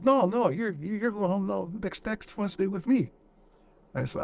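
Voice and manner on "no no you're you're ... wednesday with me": much higher, put-on insistent voice; insisting gunner. Gunner insists the soldier goes home Wednesday, high insistent voice. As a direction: do the gunner, pitched up and adamant.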